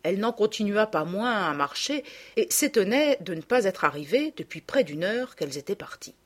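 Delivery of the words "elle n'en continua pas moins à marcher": ordinary delivery, nothing marked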